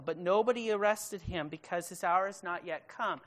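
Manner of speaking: ordinary talk, nothing marked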